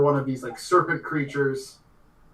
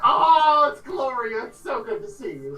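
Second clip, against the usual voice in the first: gushing greeting; 10 semitones higher, 5 dB louder than usual. Acted. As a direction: squeal hello to an old friend. Gloria greets an old friend.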